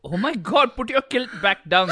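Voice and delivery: put-on high, exclaiming Indian accent; mock horror in an Indian accent. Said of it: "Do it in your Indian accent".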